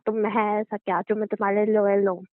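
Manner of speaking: normal talking voice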